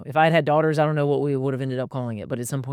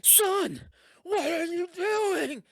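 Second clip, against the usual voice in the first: very high, slow, drawn-out "Son, what are you doing?"; slow, mocking high voice of a scandalized parent; shaming parent; 4 dB quieter, much slower than usual. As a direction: ham up a horrified, shaming parent. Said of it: "So we didn't be like".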